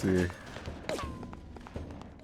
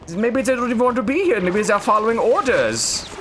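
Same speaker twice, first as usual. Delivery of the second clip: loud and fast; 14 semitones higher, 6 dB louder than usual. Real, not acted. Joking excuse, high and fast.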